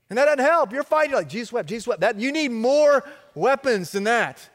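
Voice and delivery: rising sermon fervor; full-force preaching. Real, not acted. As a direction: drive it home to the congregation.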